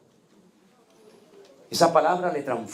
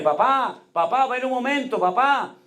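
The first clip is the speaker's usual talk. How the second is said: high, pleading call of papá, pitched well up; a lost girl calling out for her father; confused daughter calling; 12 semitones higher than usual. Retold or imitated. Sick daughter calling her father, high and pleading.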